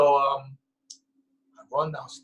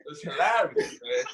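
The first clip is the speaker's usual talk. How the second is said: ordinary talk, nothing marked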